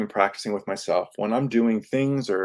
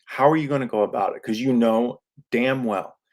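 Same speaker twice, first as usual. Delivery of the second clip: ordinary talk, nothing marked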